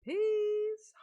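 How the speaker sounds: shouted high farewell; loud high peace. Real, not acted. Afscheid van de stream.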